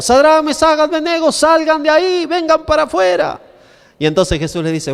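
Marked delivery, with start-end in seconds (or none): 0.0-3.4 high, urgent acted cry to come out; sustained high-pitched summons; desperate calling. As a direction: play a desperate king calling into a furnace.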